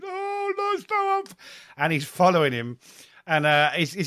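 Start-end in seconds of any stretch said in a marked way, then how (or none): 0.1-1.4 high, hammed-up fake whimper; mock crying. Lyle Taylor taunting the young centre half, hammed-up fake crying. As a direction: whine it like a mock crybaby. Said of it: "crying and doing the crying emoji".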